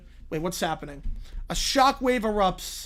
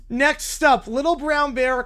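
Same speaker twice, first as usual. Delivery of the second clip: usual speaking voice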